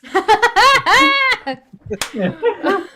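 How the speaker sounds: loud, high burst of laughter. Real, not acted. Group cracks up at table.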